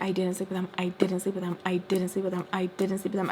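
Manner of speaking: same line over and over, evenly; insistent repetition. Retold or imitated. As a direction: reenact a dogged, repeated denial. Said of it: "I just keep saying it over and over".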